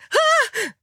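very high short shriek; startled scream. A narrator's reading. Alexa startled in bed, high scream. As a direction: shriek in fright. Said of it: "schrie Alexa".

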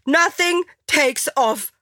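high, slow, emphatic call. A narrator's reading. Angela stopping the lovers, high emphatic call. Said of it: "rief Angela".

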